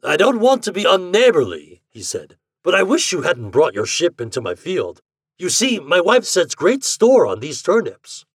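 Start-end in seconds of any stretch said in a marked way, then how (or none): 0.0-1.8 higher, louder landlord's protest; mildly aggrieved countryman; a bit upset. A narrator's reading. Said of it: "Landlord was a bit upset at this". 2.6-8.2 mildly aggrieved landlord, rising in pitch; complain, yet try to stay neighborly; put-out countryman explaining himself; a bit upset. A narrator's reading. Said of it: "Landlord was a bit upset at this".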